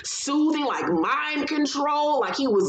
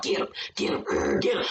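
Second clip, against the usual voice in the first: hushed, breathy sic-him urging like to a dog; egging on a dog; 8 semitones lower, faster than usual. Retold or imitated. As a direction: urge the dog on under your breath. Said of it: "how you talk to a dog".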